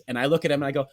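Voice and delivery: normal talking voice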